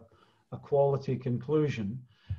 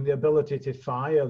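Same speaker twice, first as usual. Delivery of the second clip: normal talking voice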